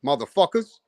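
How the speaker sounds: ordinary talk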